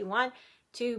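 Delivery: ordinary talk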